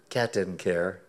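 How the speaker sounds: usual speaking voice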